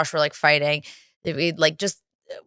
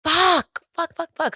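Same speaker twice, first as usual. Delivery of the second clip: freaking out; 10 semitones higher, slower than usual. Retold or imitated. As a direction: re-enact panicked swearing, first word high and punched. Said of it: "Like, freaking out. We're like".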